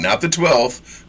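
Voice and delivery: usual speaking voice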